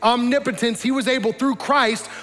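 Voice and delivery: high, loud, driving altar call; impassioned preaching. Acted. Preacher urging the congregation to repent, impassioned. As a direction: urge them forward with rising fervour. Preaches salvation at full force.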